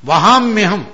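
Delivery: वहाम्यहम् said loud and high, falling; emphatic वहाम्यहम्. Shown as a demonstration. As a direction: punch out वहाम्यहम्. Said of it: "मैं खुद वहन करता हूं". (वहाम्यहम् पर ज़ोर, ऊंचा और गिरता स्वर)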